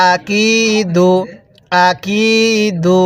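আকিদু drawn out very slowly; slow recitation. Shown as a demonstration. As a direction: chant আকিদু with the কি stretched one আলিফ. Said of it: "জেরের পরে ইয়া সাকিন". (আকিদু ধীরে টেনে পড়া)

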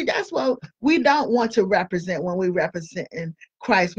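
ordinary talk, nothing marked

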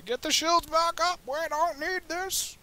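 very high, slow sing-song; high slow silly voice. Pretend. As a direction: stretch it out in a squeaky high voice.

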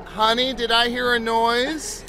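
high, slow singsong; calling mother. Acted. Mother asking child about a noise, high mom voice. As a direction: call down sweetly and draw out the question. Mother calls down once more.

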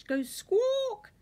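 high, loud bird squawk. Acted. Reader imitating the lookout bird's squawk, loud and high.

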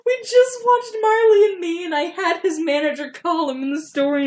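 high-pitched exclamation, easing down; incredulous outburst. Real, not acted. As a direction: burst out at the absurd coincidence. Reader reacts to her own plot.

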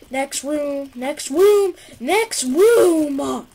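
building from plain to loud yell; escalating shout. Real, not acted. Gamer urging himself on.

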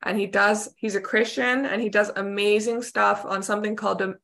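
ordinary talk, nothing marked

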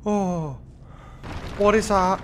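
loud, high startled question; frightened cry. Real, not acted. Sudden monster in horror game.

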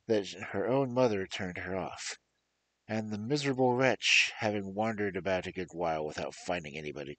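storyteller reading aloud; story narration. Acted. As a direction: tell the fairy tale to listeners. Narrates fairy tale.

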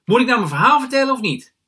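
high, loud protest over chatter; indignant shout. A narrator's reading. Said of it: "roep ik".

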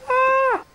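sudden high loud yelp; startled yelp. Real, not acted. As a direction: cry out as you nearly slip. Sudden cry, slipping.

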